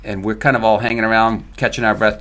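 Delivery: ordinary delivery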